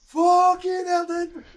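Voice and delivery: high, loud cold-shock swear; shocked yell. Retold or imitated. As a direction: blurt it as the cold hits you. Friend hits the cold water.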